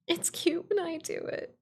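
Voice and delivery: high coy sing-song; sweetly smug; cutesy voice. Pretend. Repeat in cute voice.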